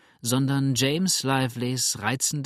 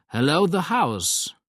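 booming call, higher and louder, slow; 5 semitones higher, 4 dB louder, slower than usual. A narrator's reading. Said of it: "rief der Alte mit dröhnender Stimme".

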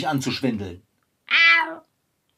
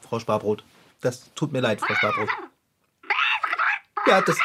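normal talking voice

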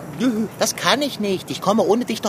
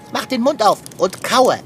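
ordinary talk, nothing marked

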